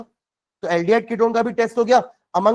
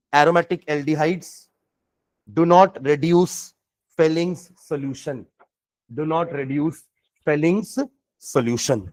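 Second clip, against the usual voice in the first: slow, drawn-out dictation voice; 4 semitones lower, much slower than usual. Shown as a demonstration. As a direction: spell it out slowly for note-taking. Dictating notes.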